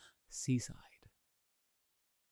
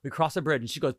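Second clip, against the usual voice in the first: ordinary talk, nothing marked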